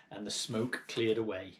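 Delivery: plain reading voice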